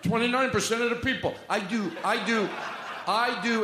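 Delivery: a light political impression; Donald Trump and Bernie Sanders. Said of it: "I do, uh, Donald Trump and, uh, Bernie Sanders together".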